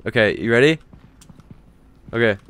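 normal talking voice

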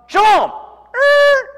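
very high, loud punchline cry; desperate shout. Retold or imitated. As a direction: blurt it out at the last second. Cowboy's desperate last word.

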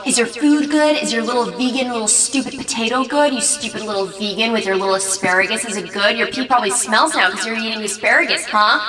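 exaggerated imitation of a taunting host; on-camera host mockery. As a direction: put on a big mocking show for the camera. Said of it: "the camera turns on, and he's like".

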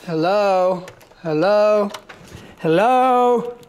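loud, high, drawn-out hellos, building; shouting for help. Acted. Calling louder from stuck elevator.

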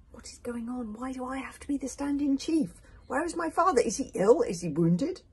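high, rising alarm; anxious questioning. Acted. Hiccup asking after his missing father, alarmed.

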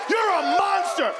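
high, drawn-out angry yell; mock-outraged shriek; screaming at cat. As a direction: scream the accusation at the cat, slowly. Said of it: "You scream at 'em".

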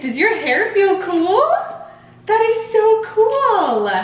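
high, slow, thrilled praise. Real, not acted. Mother delighted by baby.

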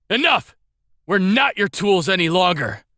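high, sharp defiant cry; defiant shout. Acted. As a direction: cut him off in anger. Defiant retort to Emperor.

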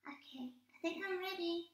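high, fast little puppet. Acted. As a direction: ask eagerly in a squeaky puppet voice. Puppet voice talking.

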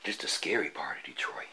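hushed whisper in a spooky place. Real, not acted. Vlogger sneaking through a scary abandoned building, whispering. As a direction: keep it breathless and hushed, creeping in.

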